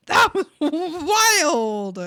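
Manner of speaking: loud, high, hugely drawn-out reaction; booming, slow exclamation; amazed outburst. Real, not acted. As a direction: stretch "wild" out in loud amazement. Reacting loudly to a clip.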